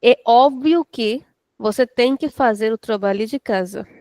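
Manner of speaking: normal talking voice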